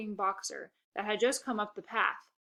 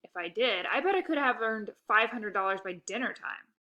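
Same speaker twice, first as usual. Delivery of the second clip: plain reading voice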